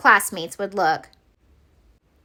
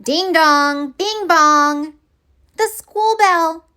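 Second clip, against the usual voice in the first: sing-song bell chime; 7 semitones higher, 4 dB louder, slower than usual. Acted. Mimicking school bell.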